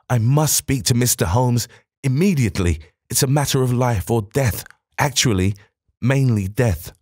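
flustered director begging Holmes; urgent visitor. Acted. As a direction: plead for help, near panic. Thompson's urgent plea.

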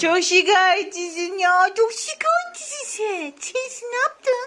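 teasing high-pitched whining coo; high mock whine. Real, not acted. Teasing complaining baby.

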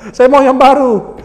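high, loud, drawn-out insistence; mock indignant demand. Debtor demanding a new prayer from preacher, mock indignant demand. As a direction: demand it loudly, stretching the words. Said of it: "yang awalnya melotot".

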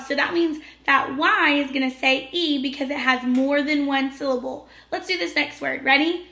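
ordinary talk

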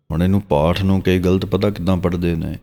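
normal talking voice

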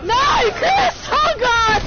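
high panicked yell at Chris; panicked shout. Real, not acted. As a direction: cry out in alarm to your partner. Panics as partner gets hit.

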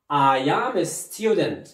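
I am a student said very slowly, stressing am; slow model sentence. Shown as a demonstration. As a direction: model it slowly for the class to echo. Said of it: "عاود معايا".

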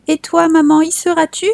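put-on high, trusting little boy's question; innocent small child. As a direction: ask mama like a trusting little boy. Child Jean asks his mother.

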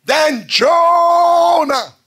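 high, loud, drawn-out call; full-force preaching shout. Real, not acted. Preacher calls out Jonah's story, long high shout. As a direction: stretch the scripture out in a big holler.

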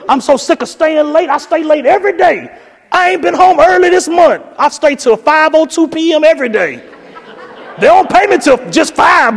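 mocking a staffer griping about two minutes late, high whine; mock complaining coworker. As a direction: ham up the martyr.

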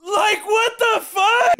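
high, loud, drawn-out disbelief; incredulous shriek. Real, not acted. Streamer hears laugh matching own, incredulous high wail.

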